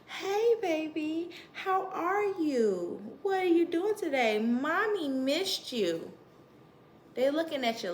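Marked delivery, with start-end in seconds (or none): 0.1-6.1 put-on high, sing-song baby talk to an infant. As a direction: coo at the baby like a mother. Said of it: "you talk to them and you say".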